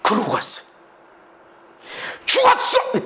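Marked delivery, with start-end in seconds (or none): 2.2-3.1 high-pitched threatening cry; sudden high outburst; re-enacted yell. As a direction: yell a threat as if alone in a car. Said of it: "막 소리를 질러도 괜찮아".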